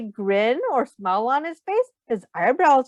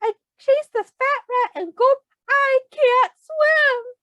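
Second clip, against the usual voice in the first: high, slow, scared mewing; frightened cat; 13 semitones higher, 4 dB louder than usual. Acted. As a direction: whimper it up high like a terrified cat. Frightened cat still pleading.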